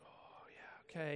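breathy hushed whisper from the head monk; quiet whisper. Retold or imitated. The head monk quietly acknowledges the buddy's silence, whispered. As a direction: whisper it gently, just between the two of you. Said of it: "kinda says quietly to me".

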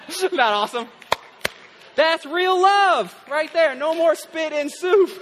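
loud delighted praise. Real, not acted. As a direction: savor the joke out loud. Delighted after story punchline.

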